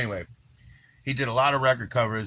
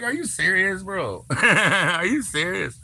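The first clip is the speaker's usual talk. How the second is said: higher, incredulous question; a surprised, higher-pitched reply; retold disbelief; 9 semitones higher, 4 dB louder, slower than usual. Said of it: "I was like".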